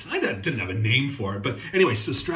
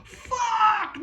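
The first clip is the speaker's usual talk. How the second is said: exasperated outburst. Real, not acted. Ranting at commenters.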